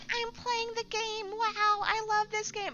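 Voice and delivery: very high, slow gushing gamer; mocking clueless gamer. Streamer mocking players who think it's release, high gushing parody. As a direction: gush like a clueless fan, way up high. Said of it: "They just go in thinking".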